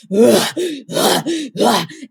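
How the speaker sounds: imitated seething beast growl; repeated breathy roars like a wild beast. Showing how she sounded in bed, beast roar. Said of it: "then a roar comes out".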